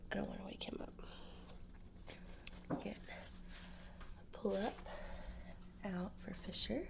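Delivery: hushed whisper near sleepers. Real, not acted. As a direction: whisper softly, don't wake anyone. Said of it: "I'm whispering because my husband".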